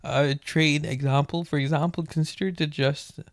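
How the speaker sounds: usual speaking voice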